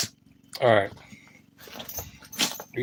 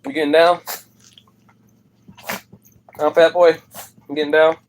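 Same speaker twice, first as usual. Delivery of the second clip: louder, higher teasing at the dog; loud, pitched-up taunt to the dog; 6 semitones higher, 5 dB louder than usual. Real, not acted. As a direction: hype the dog up playfully.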